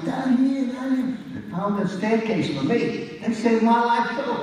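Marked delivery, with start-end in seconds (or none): 0.0-1.2 high, urgent voices calling out; people calling out. Retold or imitated. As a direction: call it out high, guiding someone down. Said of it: "they tell me".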